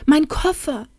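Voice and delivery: high-pitched, dismayed exclamation; stammered dismay. A narrator's reading. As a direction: blurt it out in sudden alarm. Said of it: "stammelte sie".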